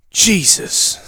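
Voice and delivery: a hint of a gruff sixty-year-old in a movie; drawn-out weary exclamation; old movie guy. Doctor hearing his pain rating, like an old movie guy. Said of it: "like some sixty-year-old guy in a movie".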